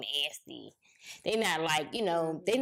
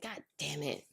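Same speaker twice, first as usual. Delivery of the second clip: muttered curse; 3 semitones lower, 9 dB quieter than usual. Retold or imitated. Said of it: "I was like".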